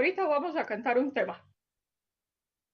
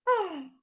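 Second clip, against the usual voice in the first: high little "ah" sigh; showing kids how he sighed; mock sigh; 7 semitones higher than usual. Shown as a demonstration. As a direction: sigh like a sad child. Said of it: "suspiró".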